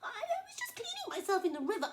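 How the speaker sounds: very high, tremulous voice; flustered flamingo. Acted. Flamingo explaining herself to crocodile, very high and flustered. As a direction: explain nervously.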